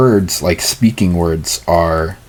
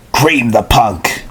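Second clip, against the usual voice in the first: understated, drawn-out battle cry; slow mock yell with little volume; mild narrated scream; 3 semitones higher, much slower than usual. A narrator's reading. Clarice to her warriors on spotting the enemy, mild scream. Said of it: "Clarice screamed".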